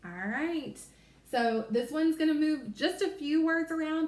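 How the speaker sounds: ordinary talk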